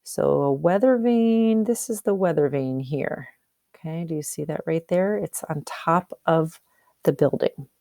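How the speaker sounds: ordinary delivery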